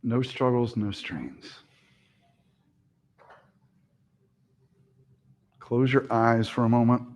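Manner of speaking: soft, unhurried relaxation voice; calm yoga teacher easing the class down; guided meditation. Acted. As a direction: settle them into stillness. Opens final relaxation.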